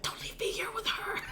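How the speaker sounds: high, hushed desperate plea; pleading whisper. Acted. Son left with his angry mother, pleading whisper. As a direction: whisper it as a secret cry for help. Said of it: "like, this expression".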